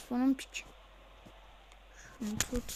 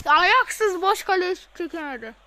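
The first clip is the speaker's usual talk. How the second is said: loud, high, fed-up yell at the screen; furious gamer yelling at his player; exasperated shout; 6 semitones higher, 10 dB louder than usual. Real, not acted. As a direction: blast it out in disbelief at the miss. Gamer fuming at missed open goal.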